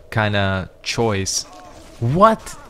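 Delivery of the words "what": high, loud rising "What?"; real surprise. Real, not acted. Player caught out by a mimic in the game, startled. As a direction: shoot the pitch up in disbelief.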